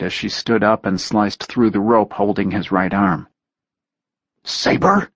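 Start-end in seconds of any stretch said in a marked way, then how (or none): 4.4-5.1 higher shocked hiss. A narrator's reading. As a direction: hiss the name in disbelief. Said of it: "Dagger hissed".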